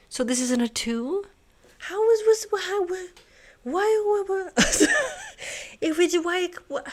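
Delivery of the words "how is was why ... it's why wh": high, disbelieving cries of why; can't believe the puzzle broke; baffled why. Real, not acted. Puzzle suddenly breaks.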